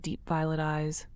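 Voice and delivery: ordinary delivery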